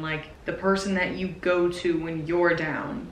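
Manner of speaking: normal talking voice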